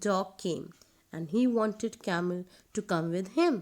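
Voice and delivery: ordinary delivery, nothing marked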